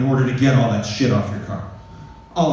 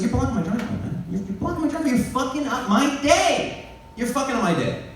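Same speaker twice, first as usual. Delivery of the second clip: mock angry retort; 13 semitones higher than usual. He snaps back at the owner.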